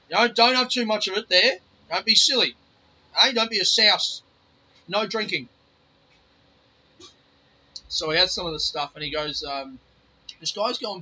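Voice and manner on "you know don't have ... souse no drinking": stern warning. Retold or imitated. Grandpa scolding about drinking.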